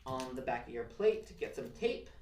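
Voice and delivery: ordinary talk, nothing marked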